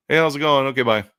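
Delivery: loud, high, quick dismissive hello and goodbye; brushing a customer off fast; curt brush-off greeting. Retold or imitated. Vendor brushing off a booth visitor, curt and loud. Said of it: "hear our tone almost like a song".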